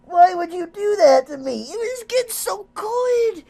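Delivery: high, drawn-out, aggrieved wail; whining lament. Real, not acted. Player mourning a scene getting so good, whining lament. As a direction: plead loudly, stretching the words.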